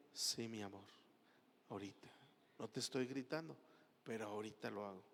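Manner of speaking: low, hushed placating husband; speaking softly. Acted. Husband answering his yelling wife, speaking softly. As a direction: keep it very soft and low, placating her. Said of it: "Y le hables suavecito".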